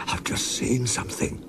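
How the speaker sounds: frightened whisper; shaky whisper. A narrator's reading. Toby whispers to Thomas about the ghost, scared. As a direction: breathe it out scared. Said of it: "in a shaky voice".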